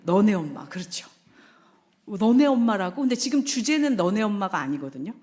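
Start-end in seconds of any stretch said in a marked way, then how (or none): 0.0-0.7 offended husband. Retold or imitated. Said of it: "뭐에 화가 나죠?". 2.1-3.0 loud, bristling repeat of the phrase; indignant echo. Retold or imitated. Husband bristling at his wife's words, loud and indignant. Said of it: "뭐에 화가 나죠?".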